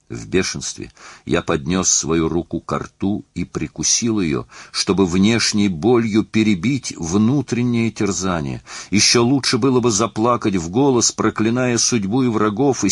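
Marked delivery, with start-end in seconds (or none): none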